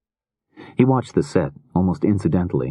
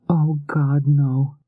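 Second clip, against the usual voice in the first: crying out; 12 semitones higher, much slower than usual. Acted. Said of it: "began to cry".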